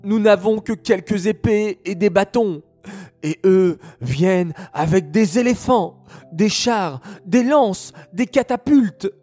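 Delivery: scared fighters stammering in fear; frightened soldiers. Acted. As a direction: panic at the size of the enemy. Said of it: "ils commencèrent à trembler".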